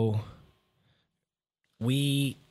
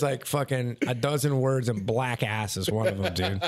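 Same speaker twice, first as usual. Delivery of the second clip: normal talking voice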